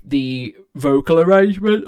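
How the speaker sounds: words stretched out by a yawn. Real, not acted. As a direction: say it mid-yawn. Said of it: "I keep yawning".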